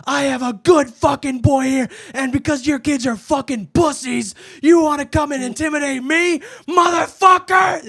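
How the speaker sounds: high, loud furious shouting; screaming mom. Retold or imitated. Mom defends her son, yelling high and loud. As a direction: defend your boy at full volume. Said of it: "screaming at them".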